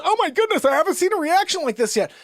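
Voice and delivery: high, hurried imitation of a doctor; mock alarmed doctor. Doctor sees Joanne's reaction, mock high alarm. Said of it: "Doctor goes".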